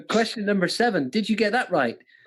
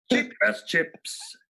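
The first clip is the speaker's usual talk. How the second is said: slow, stretched catchphrase delivery; TV catchphrase impression; 3 dB quieter, much slower than usual. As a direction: draw each word right out as a catchphrase. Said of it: "what programme is this from?".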